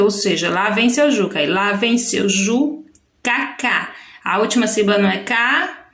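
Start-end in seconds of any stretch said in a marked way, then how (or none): 1.5-3.9 "lá vem seu Ju" slowed, then "K, K" as separate echoes; "Ju, K, K" in rhythmic separate beats; repeated last syllable. Shown as a demonstration. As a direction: hold "Ju", pause, then echo "K, K". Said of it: "a última sílaba de cada palavra".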